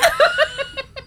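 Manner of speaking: a high shriek of laughter; real shrieking laugh. Real, not acted. Cracking up over Sylvia.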